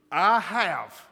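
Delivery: I HAVE hit hard and loud; emphatic confession. Acted. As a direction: hammer out "I have" as a bold declaration. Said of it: "Look at all the times he hits this".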